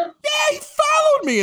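very high, exasperated shout; incredulous outrage. Real, not acted. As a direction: cry it out in comic disbelief. Exasperated reply, confirming he was followed.

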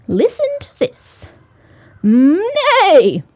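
high loud horse whinny; horse neigh. Acted. Horse teaches the kitten.

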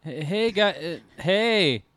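high, loud, stumbling greeting; jolted awake. Pretend. Sleeper roused by the hosts, mock startled and loud. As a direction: fumble the hello as if just woken.

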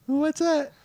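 very high falsetto question; squeaky son character. Acted. Son asking about the surprise, squeaky falsetto. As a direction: squeak it like a comic child.

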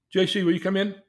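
high, loud call into the room. Retold or imitated. Therapist calling Jesus into the classroom, loud and high. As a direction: summon him in with a big voice.

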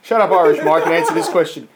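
cutting off the nonsense, loudly; loud exasperated order. Real, not acted. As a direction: shut them down with volume. Quizmaster cuts off the silly character.

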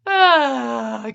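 ordinary talk, nothing marked

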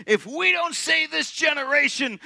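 mock alarmist shout; hammed-up rally cry; a touch of a fired-up youth preacher. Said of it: "the whole premise of the book was".

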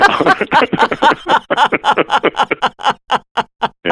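long, high chuckling fit; long laugh. Real, not acted. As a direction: keep laughing at the joke. Laughs at the Bart Simpson joke.

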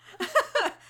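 a short, high laugh. Real, not acted. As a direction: laugh it off lightly. Laughs at the maid joke.

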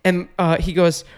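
ordinary talk

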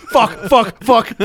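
high, loud repeated curse; loud swearing. Real, not acted. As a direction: shout the curse three times. Swearing loudly to provoke bleeps.

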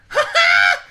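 high, loud mocking guffaw. Mimicking the guy laughing in the theater, loud guffaw. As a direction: bark out an obvious belly laugh. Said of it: "more of an obvious, like".

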